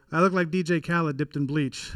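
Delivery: ordinary delivery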